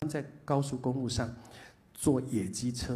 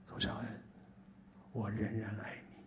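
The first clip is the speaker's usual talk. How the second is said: 1 gentle voice of God; 4 semitones lower, 7 dB quieter than usual. Retold or imitated. Voicing God's gentle words again.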